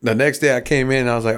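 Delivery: usual speaking voice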